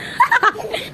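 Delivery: sudden squealing high laugh. Real, not acted. Mother laughing at her squabbling children, high squeal. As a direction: burst into a high giggle.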